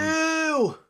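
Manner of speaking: very high, squeamish squeal; disgusted. Real, not acted. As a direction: squeal it in revulsion. Disgust at sliced raw beef.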